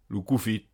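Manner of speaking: "koufit" with no N before the F; silent N. Shown as a demonstration. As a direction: leave out the N in "koufit". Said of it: "le N peut ne pas être articulé". (« koufit » sans N articulé devant F)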